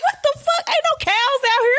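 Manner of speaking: high-pitched retold disbelief; hammed-up bewilderment; incredulous. Retold outraged reply to accent teasing.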